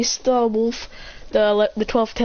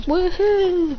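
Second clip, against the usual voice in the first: high, light woohoo; acted gleeful whoop; playful cheer; 9 semitones higher than usual. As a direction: cheer like kids at play. Children having fun.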